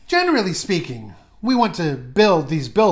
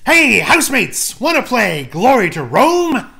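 loud cheery show opener; excited host greeting; 4 semitones higher, 6 dB louder than usual. Acted. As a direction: call out to the housemates brightly. Host's loud cheery opening.

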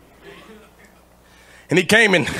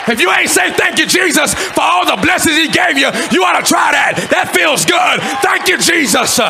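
full-force preaching; 12 semitones higher than usual. Real, not acted. Preacher urging the congregation to praise, full-force shouted preaching. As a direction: shout it out to fire up the church.

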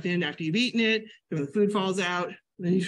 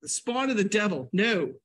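high, recoiling refusal; mock horror; 3 semitones higher than usual. Refusing cream cheese, high hammed-up horror. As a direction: recoil higher, hammed-up horror at the devil food. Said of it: "I look at that and go".

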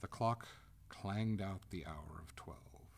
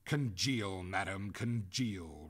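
loud, slow, cold command; 6 dB louder, slower than usual. A narrator's reading. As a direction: order it coldly, drawing out the words. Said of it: "returned Oglethorpe coldly".

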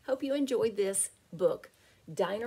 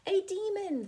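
high cry of fear; frightened cry; 13 semitones higher than usual. A narrator's reading. Dinosaurs in the den crying out in fear. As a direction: cry out in alarm. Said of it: "they cried".

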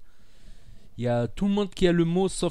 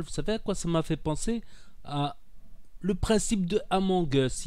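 ordinary delivery

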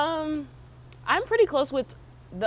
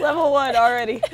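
laughing; 5 semitones higher, 8 dB louder than usual. Real, not acted. Laughs reacting to sour candy.

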